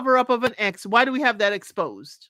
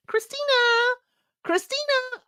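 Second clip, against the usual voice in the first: imitated yell; 13 semitones higher than usual.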